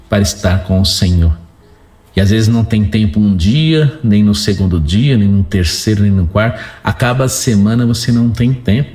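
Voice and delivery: ordinary talk, nothing marked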